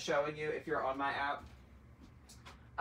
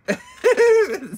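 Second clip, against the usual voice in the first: a burst of high loud laughter; a real loud laugh; 14 dB louder than usual. Real, not acted. Laughing at the friend's remark.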